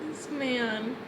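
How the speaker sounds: moved, stretch it out slowly; close to tears. Real, not acted. Reader reacting to a man crying in her book, tearful. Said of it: "I'm gonna start crying".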